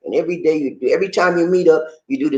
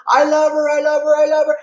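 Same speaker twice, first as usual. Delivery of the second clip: high mock proclamation; 11 semitones higher, 3 dB louder than usual. Proclaiming love to the world.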